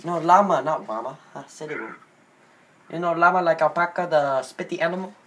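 put-on halting Indian-accented English. Prank caller asking a wings place for llama, in Indian accent.

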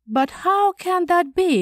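high-pitched, incredulous question; amazed cry. A narrator's reading. Shocked colleague questions the narrator's calm, amazed cry. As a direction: cry it in astonishment, voice lifted. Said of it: "he cried, amazed at my calm".

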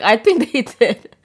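loud, slow, laughing retort; amused retort. Retold or imitated. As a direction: draw it out loudly through amusement.

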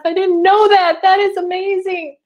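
genuinely taken aback with delight; real wonder at good news; amazed. Real, not acted. Amazed reading chat comment.

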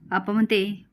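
plain reading voice

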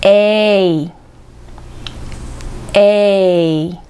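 "えー" as a long diphthong, strong e gliding to i; drawn-out "えー" said as English "ay"; English letter A. Shown as a demonstration. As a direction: lean on the first vowel of "えー", then close to i. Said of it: "最初の母音を強く発音".

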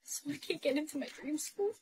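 very soft, deflated question; crushed. Acted. Girl learns Harvard is out of reach, crushed. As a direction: ask it in a small, hushed voice.

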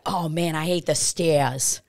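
slow attempt at a Boston accent. Imitating lady who climbed the stairs, Boston accent. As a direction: drop the R, Boston style. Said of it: "how to do the Boston accent".

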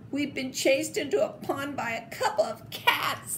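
embarrassed dogs laughing at themselves; moaning with a laugh. A narrator's reading. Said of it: "they moaned".

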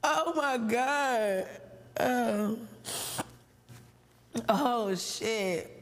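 slow drawn-out gasp after laughing. Real, not acted. Host catching her breath after laughing, slow. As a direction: breathe it out slowly, still laughing.

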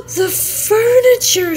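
high, loud, slow cry of dismay; shocked cry. Acted. Housewife finds her furniture ruined, high loud slow cry. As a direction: wail it out in horror. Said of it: "she cried".

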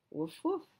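high puppy yips; puppy woof. Acted. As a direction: bark like a small dog. Puppy barks in story.

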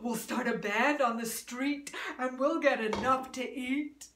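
lightly voiced donkey. Donkey voice in story.

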